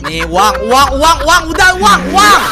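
frantic handover, voice climbing with each word; panicked pleading. Acted. Panicked handing over money. (panik makin keras dan tinggi)

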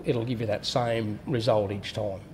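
normal talking voice

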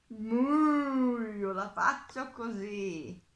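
loud moo, then slow, heavy cow voice; slow storybook cow. A narrator's reading. Cow answers the mole in story.

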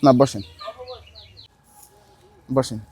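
urging the man to sit, reassuringly; soft reassurance. Real, not acted. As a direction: settle him with a calm voice. Gently seating the man.

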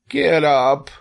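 high, forceful piglet order; exasperated scream. A narrator's reading. Wilbur orders the lazy rat up, exasperated scream. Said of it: "screamed Wilbur".

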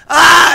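loud, high "ah" of sudden pain; re-enacted pain cry. Retelling the fall in the shower, mock pain cry. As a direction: cry out as the back seizes up. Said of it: "and I'm like".

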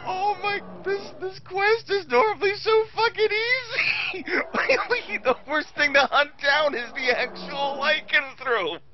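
high, laughing disbelief; cracking up in disbelief. Real, not acted. Laughing outburst at a hard quest.

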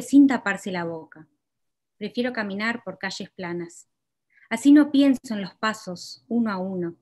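measured, deliberate poetry reading; poem reading. Acted. Poet reading her own poem to the audience, measured. Reads second Spanish poem aloud.

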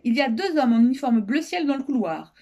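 usual speaking voice

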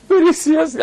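trying to be serious through laughter; high, giggly attempt at seriousness; laughing. Real, not acted. Speaker fighting laughter while telling his story, laughing. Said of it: "it's funny".

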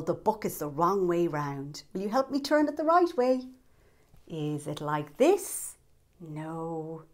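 usual speaking voice

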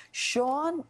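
normal talking voice

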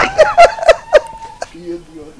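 loud, squealing laughter; high-pitched laugh. Acted. Celebrating with a lover, high laugh.

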